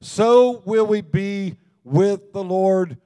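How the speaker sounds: scripture reading with force. Acted. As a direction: proclaim the verses to the congregation. Reads scripture aloud to congregation.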